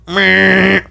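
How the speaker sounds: imitated arena buzzer; buzzer noise. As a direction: sound the period buzzer with your voice. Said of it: "buzzer goes off".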